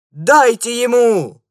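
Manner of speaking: loud, slow, high shout from the crowd; onlooker's shout. A narrator's reading. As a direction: egg the fighter on at full voice. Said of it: "посоветовал кто-то из зрителей".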